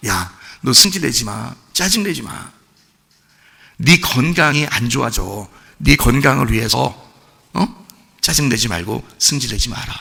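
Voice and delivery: God speaking tenderly. Acted. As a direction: speak as a caring father to a child. Voicing God's loving command. (아이를 타이르는 아버지처럼 부드럽고 낮게)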